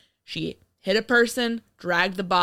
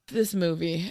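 ordinary talk, nothing marked

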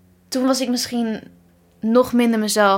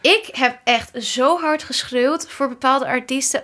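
usual speaking voice

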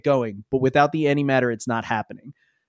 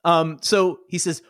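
usual speaking voice

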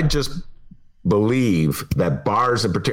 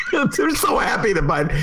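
normal talking voice

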